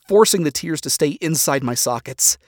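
ordinary delivery, nothing marked

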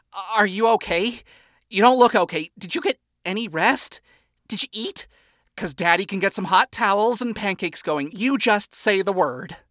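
high, worried questions tumbling out; fussing father. A narrator's reading. Lucifer fussing over his exhausted daughter, worried. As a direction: fuss over her like a doting dad. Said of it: "Lucifer offered in rapid succession".

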